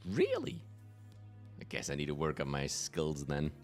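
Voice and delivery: ordinary talk, nothing marked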